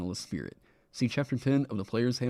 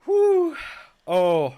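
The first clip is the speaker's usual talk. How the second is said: high, loud whoop of joy; excited; 9 dB louder than usual. Real, not acted. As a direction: cheer it out, elated. Celebrating four thousand subscribers.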